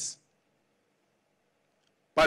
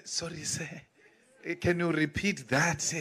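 meek, much softer apology; submissive; 3 semitones lower, 6 dB quieter than usual. Retold or imitated. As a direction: shrink small and beg pardon, very softly. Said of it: "this submissive attitude".

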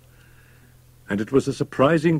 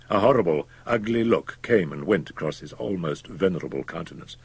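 plain reading voice

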